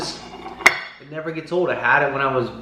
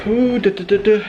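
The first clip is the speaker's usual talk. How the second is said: exhausted groan; 9 semitones higher than usual. Real, not acted. After running stairs, exhausted.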